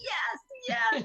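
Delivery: squealed high yes yes; imitating her own eager inner yes; excited yes. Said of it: "Can you tell I'm getting all excited?".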